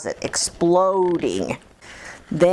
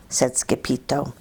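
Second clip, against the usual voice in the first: usual speaking voice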